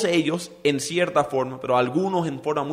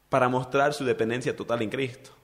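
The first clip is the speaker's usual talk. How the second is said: ordinary talk, nothing marked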